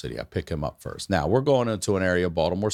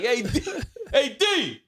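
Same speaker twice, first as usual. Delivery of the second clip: raised, pitched-up call across the street. Retold or imitated. Friend calls out to the debtor on the street, pitched up. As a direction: hail him from the car.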